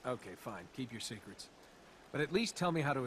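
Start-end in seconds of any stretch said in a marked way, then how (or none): 0.1-1.5 lower, softer sulky grumble; grumbling. Acted. Prince to Elika after she dodges his question, sulking. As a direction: mutter it, sulking.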